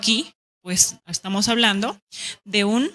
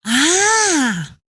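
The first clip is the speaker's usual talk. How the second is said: exclaimed ah; 7 semitones higher, 4 dB louder than usual. Shown as a demonstration.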